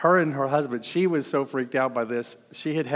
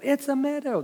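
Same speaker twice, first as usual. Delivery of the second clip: much higher, cheery it's a meadow; an optimist gazing at a meadow, pitched up and cheery; bright high voice; 13 semitones higher than usual. Retold or imitated. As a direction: chirp it high like a happy optimist.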